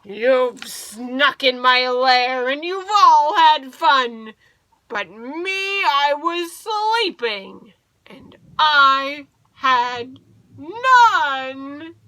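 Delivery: loud, aggrieved bear voice; hammed-up angry bear with hurt feelings; grumbling bear. A narrator's reading. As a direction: complain loudly, dragging out the last words. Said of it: "Bear growls and he grumbles".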